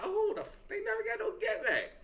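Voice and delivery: shrieking high mock reaction; high squeaky imitation of himself; mock high squeal. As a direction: squeal it way up like a cartoon. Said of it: "I'm like".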